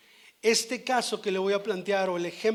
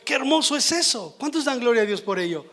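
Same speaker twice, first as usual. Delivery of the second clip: higher, louder burst of delight; joyful exclaim; 5 semitones higher, 6 dB louder than usual. Real, not acted. Preacher exulting before the congregation, loud joyful exclamation. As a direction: exclaim it in wonder.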